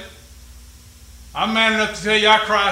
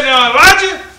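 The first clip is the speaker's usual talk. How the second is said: loud, higher retold shout to Elijah; servant shouting back; 6 semitones higher, 9 dB louder than usual. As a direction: call it out insistently. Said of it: "the guy came back and said".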